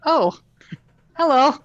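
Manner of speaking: high startled "oh, hello". Pretend. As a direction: jump in surprise, then greet. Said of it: "like, a startled noise".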